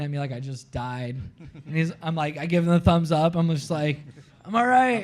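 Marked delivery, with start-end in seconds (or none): 4.4-5.0 high, bright call of I'm all right; cheery reassurance. Retold or imitated. Surfer calls to Keoki after the wipeout, high reassuring call. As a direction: shout it out to show you're fine.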